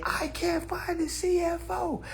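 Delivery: a lightly voiced helpless follower; mock whiny caller. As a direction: whine it like you're lost. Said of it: "people used to say".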